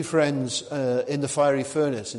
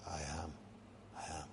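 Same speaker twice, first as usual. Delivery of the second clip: very low, soft declaration; hushed divine answer; 9 semitones lower, 20 dB quieter, faster than usual. Acted. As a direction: drop right down, quiet and low. God answering who are you.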